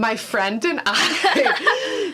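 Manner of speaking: laughing through the words. Real, not acted. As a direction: giggle while confessing. Laughing confession.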